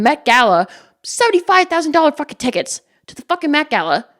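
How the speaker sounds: heated, indignant tirade; real anger, hammering the key words; angry rant. Real, not acted. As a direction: build from outrage to a loud, exasperated "Why?". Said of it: "it makes me so fucking angry".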